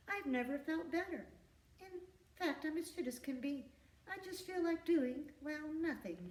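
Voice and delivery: light, high, innocent little doozer voice; innocent young doozer. A narrator's reading. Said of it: "said the doozer".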